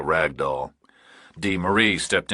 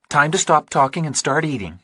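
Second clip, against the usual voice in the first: higher, lighter woman's voice, unhurried; Dee Marie's voice; 8 semitones higher than usual. A narrator's reading. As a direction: read her as a steady, practical woman.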